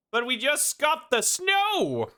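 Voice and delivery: high, slow, exaggerated Swedish accent; scuffed Swedish accent. Streamer echoing the American actor, scuffed Swedish accent. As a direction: wail it in a caricature Swedish accent. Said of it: "in the imitation".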